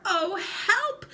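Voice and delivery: high, shrill-pitched cry for help; scream for help. Acted. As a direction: wail for help. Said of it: "screamed Missus Twit".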